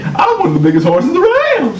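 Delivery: very high-pitched talking horse; silly horse voice. Acted. Commercial Clydesdale boasting to friends, silly horse voice. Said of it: "you don't have to do that voice".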